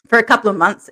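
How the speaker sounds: ordinary delivery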